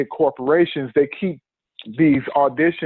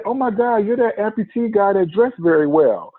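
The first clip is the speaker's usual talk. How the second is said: higher, excited fan gush; excited recognition; 7 semitones higher than usual. Retold or imitated. Casting director spotting the well-dressed amputee, excited gush. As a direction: gush like you just spotted someone famous.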